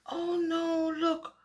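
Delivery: ordinary delivery, nothing marked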